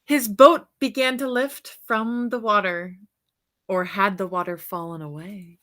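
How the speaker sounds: put-on bright, lively read-aloud for children; storybook reading. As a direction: read it like a bedtime picture book to kids. Reads picture book aloud.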